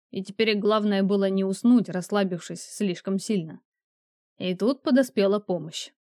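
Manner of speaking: ordinary delivery, nothing marked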